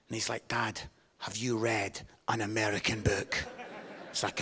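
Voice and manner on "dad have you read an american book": low, dry imitation of a son; deadpan son. Son seeing through Dad's speech, imitated deadpan. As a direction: question Dad in a lightly played deadpan. Son's suspicious question retold.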